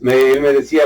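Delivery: ordinary talk, nothing marked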